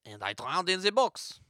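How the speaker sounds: put-on French-accented mime. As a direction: finish the story as a French mime. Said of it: "let me translate".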